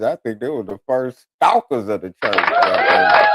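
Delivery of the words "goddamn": high, loud squealed "goddamn"; hammed-up high-pitched cry; mock exclamation. Mock exclamation about stalkers.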